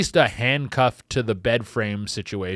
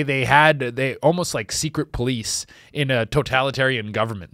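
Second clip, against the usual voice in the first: usual speaking voice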